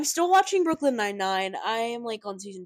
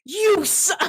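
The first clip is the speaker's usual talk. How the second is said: high, loud acted cursing; mock outrage; 9 semitones higher, 6 dB louder than usual. Mother curses the too-literal doctor, mock fury.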